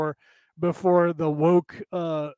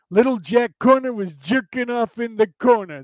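higher, louder brash comic rhyme; Andrew Dice Clay delivery; 5 semitones higher, 5 dB louder than usual. Retold or imitated. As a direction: belt it out like a brash stand-up comic bit. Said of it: "That's the way he talked".

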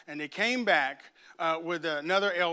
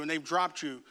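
usual speaking voice

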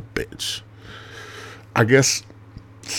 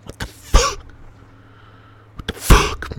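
stunned what the fuck; 5 dB louder than usual. Retold or imitated. Repeated outburst.

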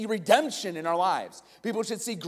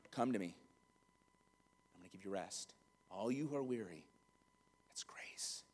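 gentle Jesus; 11 semitones lower, 18 dB quieter than usual. Retold or imitated. Preacher voicing Jesus calling the weary, soft and tender. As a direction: lower and hushed, welcoming the weary. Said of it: "he's like".

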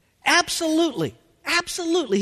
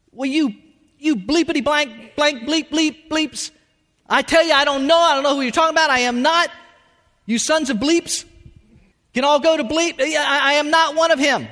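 raised, loud, sputtering outburst; angry cursing denial; 6 semitones higher, 5 dB louder than usual. Acted. Peter cursing his accusers at the fire, loudly furious. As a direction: rant defensively at the accusers, high and loud.

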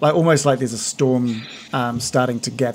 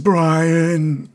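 Brian's voice; 3 semitones higher than usual. Acted. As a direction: say his own name gruffly. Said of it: "he nods and just says".

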